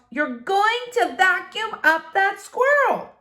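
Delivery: hearing her own shout again; echoed shout. Acted. Quoting her own shout, amazed.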